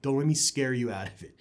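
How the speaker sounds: usual speaking voice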